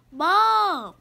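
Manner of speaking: drawn-out, falling call to mom; a complaint stretched into one long word; pleading child. Acted. Kitten stalling his mom before school, pleading.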